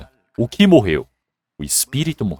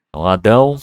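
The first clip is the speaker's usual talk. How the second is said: usual speaking voice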